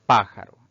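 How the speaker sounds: "pájaro" with a strong first syllable; antepenultimate stress. Shown as a demonstration. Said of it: "la mayor fuerza de voz recae".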